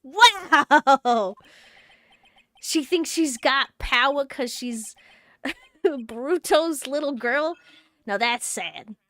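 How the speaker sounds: loud scoff into New York tough-guy talk; mocking New York accent; East Coast accent. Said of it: "love the East Coast accent".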